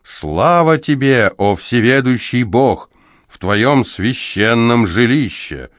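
solemn exclamation. Acted. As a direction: proclaim glory to the god. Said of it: "воскликнул Небамон".

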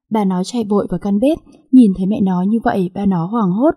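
ordinary delivery, nothing marked

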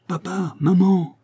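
put-on high, drawn-out child's call; small boy's high cry from afar. A narrator's reading. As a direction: call for your parents like a young boy. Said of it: "criait-il".

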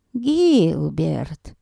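put-on creaky old woman calling Gilbert. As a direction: call out like a creaky grandma. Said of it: "Прозвучал скрипучий женский голос".

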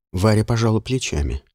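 plain reading voice

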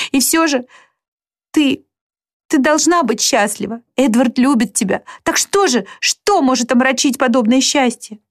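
put-on high, anguished young sister; distraught girl lashing out at her sister; Marianne voiced. (высокий, страдающий голос Марианны)

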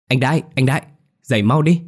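high, loud urgent calling; urgent wake-up call. Acted. Wife rousing her dreaming husband, calling loud and high. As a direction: shake him awake with your voice.

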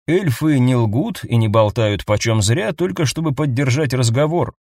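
ordinary delivery, nothing marked

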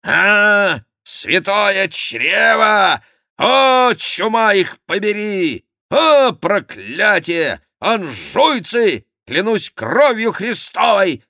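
high, loud, drawn-out curses; ferocious raving. A narrator's reading. Chicot lays about him with his sword, ferocious shouted curses. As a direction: rant like a frenzied swordsman. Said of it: "свирепо вращая глазами и повторяя".

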